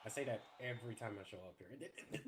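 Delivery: chuckling through the words; laughing. Real, not acted. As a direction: laugh as you answer. Laughing reply.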